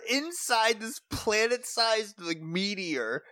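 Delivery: usual speaking voice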